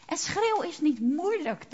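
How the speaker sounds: ordinary talk, nothing marked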